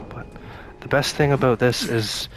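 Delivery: normal talking voice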